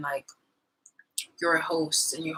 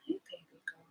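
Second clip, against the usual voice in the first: cooing whisper; 15 dB quieter than usual. Real, not acted. Cooing at baby.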